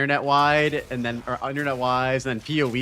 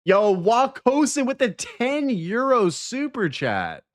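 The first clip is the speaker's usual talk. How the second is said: drawn-out, high hype shout-out; hyped gamer bro; 11 semitones higher, slower than usual. Real, not acted. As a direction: announce the donation like a hype host. Reading a super chat aloud.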